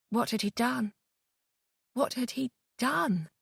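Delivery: soft, stunned whisper of a thought; murmured to himself in disbelief; hushed horror. A narrator's reading. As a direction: let the horror sink in quietly. Horrified inner realisation.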